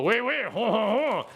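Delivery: hammed-up French voice while playing the viewer; mock French accent. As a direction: chuckle like a cartoon Frenchman. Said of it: "says Transformers Joe".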